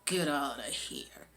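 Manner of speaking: low, soft, slow menace; menacing villain. Retold or imitated. Villain to armed store guy, quiet menacing order. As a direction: say it low and slow, without effort. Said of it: "All he said was".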